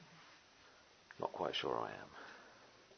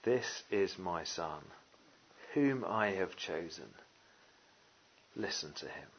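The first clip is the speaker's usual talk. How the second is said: slow, softer, lower solemn words; voice of God; 5 semitones lower, 7 dB quieter than usual. Acted. Preacher voicing God the Father, slow and hushed. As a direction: let each word fall slowly and hushed. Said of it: "what does he say?".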